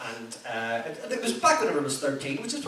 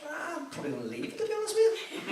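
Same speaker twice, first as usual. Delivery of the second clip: much higher, sheepish little voice; higher, softer, apologetic cop-out; meek excuse; 5 dB quieter than usual. Retold or imitated. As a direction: squeak out a timid excuse. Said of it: "I'd always be like".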